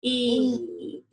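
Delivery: E drawn out as its own sound; isolated sound. Shown as a demonstration. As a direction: hold a long E for a class counting sounds. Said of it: "Let's say the sounds in key".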